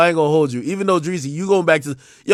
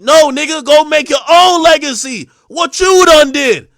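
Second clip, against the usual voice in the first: loud, higher scolding, the last words slowed; loud call-out; 5 semitones higher, 6 dB louder than usual. Real, not acted. Streamer yelling at DeAndrea to go make her own legacy, loud scolding. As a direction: yell at her to earn it herself.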